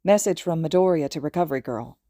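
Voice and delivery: plain reading voice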